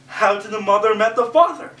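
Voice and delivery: normal talking voice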